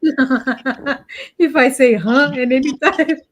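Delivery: joking through real laughter; laughing. Real, not acted. Listener joking about saying huh, really laughing.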